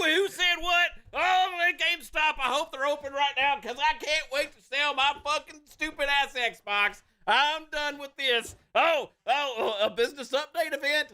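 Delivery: high, drawn-out hysterical whining; hammed-up wail of an overwrought fan; mock panicked fan. Podcaster mocking paranoid Xbox fans over rumors, mock-hysterical.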